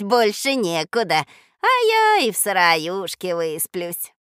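put-on an old peasant woman fussing over guests; kindly old woman. Голос старушки. (деревенская старушка неспешно хлопочет)

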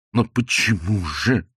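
slow mooing lament, rising in pitch; bovine passion Lokhankin. A narrator's reading. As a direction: draw it out like a lovesick cow. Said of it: "сказал Лоханкин с коровьей страстностью". (протяжно, с коровьей страстностью)

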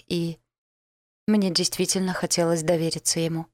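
ordinary delivery, nothing marked

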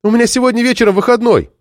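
put-on high-pitched girl's insistent plea; pleading call. A narrator's reading. Girl argues for a trip to town, high pleading call. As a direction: plead high over an engine.

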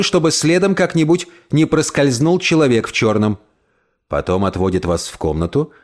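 usual speaking voice

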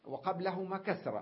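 normal talking voice

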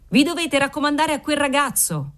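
high, merry call without real volume; cheerful yell. A narrator's reading. Waiter advising the departing seamstress, cheerful mock yell. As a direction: call out gaily, pitch held high. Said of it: "urlò allegramente il garzone".

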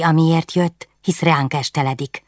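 usual speaking voice

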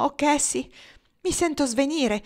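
put-on high, frightened cry of a girl about to faint; faint with fear. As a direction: gasp it out, terrified and weak. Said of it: "le esclamò". (esclamazione spaventata, più acuta)

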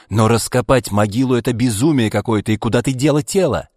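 irritated. A narrator's reading. Ivan scolding his sister over the dug-up grave, irritated. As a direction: press her, fed up and loud. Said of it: "раздражённо сказал Иван".

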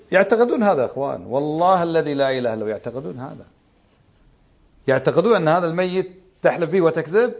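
usual speaking voice

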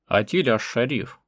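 loud, drawn-out hail; slow ringing shout of address; calling out. A narrator's reading. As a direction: call out to someone across a distance. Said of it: "закричал Эргес".

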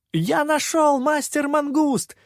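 put-on high, loud childlike yell; excited yell. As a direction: yell it out, thrilled with the find. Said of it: "завопил попрыгун".

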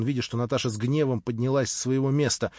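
usual speaking voice

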